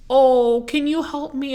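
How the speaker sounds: put-on high old lady voice opening on a loud "Oh"; old lady customer. Old lady asks the store worker for help, high voice.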